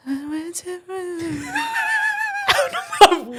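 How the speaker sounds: high, wavering mock singing noise; imitating feeble singing. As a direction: mimic a boy failing to sing. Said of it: "And then he goes".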